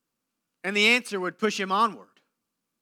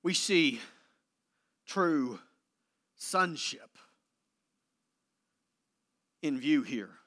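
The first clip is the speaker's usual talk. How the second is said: softer, lower, confiding preacher's voice; hushed sermon; 8 semitones lower, 8 dB quieter than usual. Acted. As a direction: draw the listeners close.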